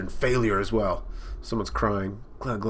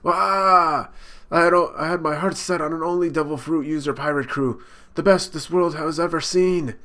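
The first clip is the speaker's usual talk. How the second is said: put-on higher, louder, weepy drunk pirate boss; sentimental drunk Kaido; 6 semitones higher, 4 dB louder than usual. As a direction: wail it like a drunk who lost his dream. Drunk Kaido crying over lost dream.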